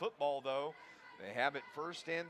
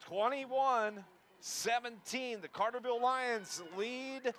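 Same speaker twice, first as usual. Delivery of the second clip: drawn-out score call; 6 semitones higher, slower than usual. Real, not acted. Score announcement on radio.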